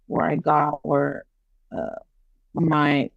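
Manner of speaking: ordinary delivery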